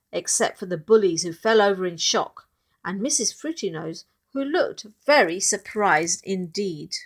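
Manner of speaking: usual speaking voice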